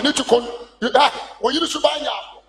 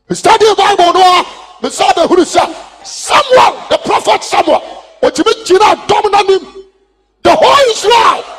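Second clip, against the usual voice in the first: full-force preaching; 6 semitones higher, 9 dB louder than usual. Acted. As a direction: thunder the lesson at the congregation.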